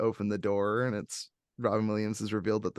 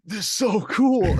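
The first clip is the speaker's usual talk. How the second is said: put-on excited kid; 8 semitones higher, 4 dB louder, slower than usual. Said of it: "the boy's like".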